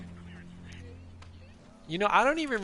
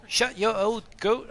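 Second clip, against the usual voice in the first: higher, slow attempt at an accent; Mozak; 8 semitones higher, 3 dB louder than usual. Streamer retrying his impression of a chatter, slow failed accent. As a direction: put on a botched accent, drawn out and raised. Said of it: "I can't do an accent".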